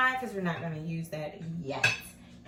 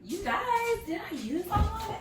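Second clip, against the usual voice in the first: surprised; 14 semitones higher, 3 dB louder than usual. Real, not acted. Realizes the onion is missing.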